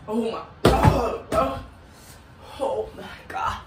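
high, slow pained groan. Real, not acted. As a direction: drag it out in burning pain. Burning pain after spicy chip.

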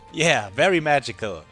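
ordinary talk

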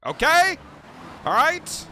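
loud, high rising questions; exasperated demand. Real, not acted. Player insisting the replay is useless, exasperated and loud. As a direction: punch each question up sharply. Exasperated after repeating look.

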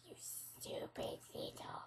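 unvoiced muttered insult; whispering. Real, not acted. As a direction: whisper the insult under your breath.

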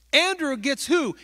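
ordinary talk, nothing marked

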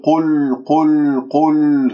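"قل" with lips still rounded through the ل; dark, pursed ل at the end of "قل"; rounding dragged onto lam. Shown as a demonstration. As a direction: demonstrate the mistake: pull the u of ق into the ل of "قل". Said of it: "لا تسحب ضمة القاف إلى اللام".

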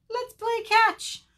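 high, loud, drawn-out cheer; eager little bird proposing a game; excited calling. A narrator's reading. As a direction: sing it out high to friends. Bird calls friends to play.

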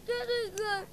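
soft, high, tearful whimper; child pouting and whimpering to grandpa; whimpering refusal. Acted. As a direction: whine it quietly through tears. Crying child refuses to eat.

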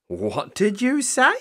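high, slow, rising disbelief; astonished gasp. A narrator's reading. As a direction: gasp the question as a startled grandmother. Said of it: "gasped Grandma".

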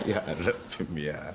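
wheezing laugh through the words; laughing helplessly. Acted. The boss laughs at the boast, gasping. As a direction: laugh so hard the words barely come out.

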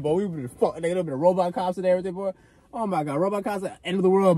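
normal talking voice

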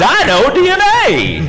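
very high, slow squeaky cartoon voice; cartoon DNA voice. Mimics Jurassic Park DNA cartoon.

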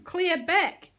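loud, high command; shouted. A narrator's reading. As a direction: shout it to push a crowd back. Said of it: "shouted a woman".

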